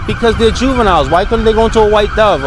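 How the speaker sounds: retold argument, voice climbing in exasperation; heated retelling. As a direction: push back at him with rising indignation. Retold confrontation with the man.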